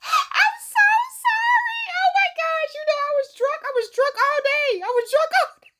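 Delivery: high, giggly, sheepish sorry; laughing apology. Real, not acted. Apologizing to her sister, giggling and high. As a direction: squeal the apology through laughter.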